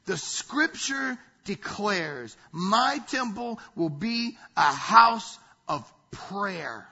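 slow, emphatic, loud proclamation; loud declaration. Retold or imitated. Preacher quoting Jesus in the temple, loud declaration. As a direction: declare it slowly, building in volume. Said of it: "he says this loudly, I'm sure".

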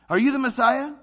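a crowd calling out, eager for an answer; eager crowd shout. Retold or imitated. Crowd questioning Jesus.

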